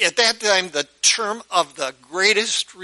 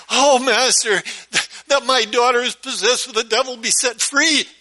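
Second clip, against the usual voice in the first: higher, louder desperate plea; pleading woman; 6 semitones higher, 3 dB louder than usual. Retold or imitated. Mother begging Jesus to heal her daughter, high and pleading. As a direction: beg the master to free her child.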